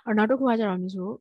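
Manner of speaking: ordinary talk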